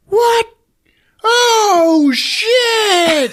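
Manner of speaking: very high, loud mock shriek; shocked crowd shout. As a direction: yell like a whole crowd in shock. Crowd reacting to the stab.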